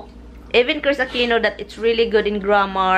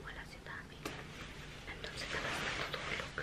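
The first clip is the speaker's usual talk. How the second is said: whispering; 24 dB quieter than usual. Real, not acted. Husband asleep upstairs.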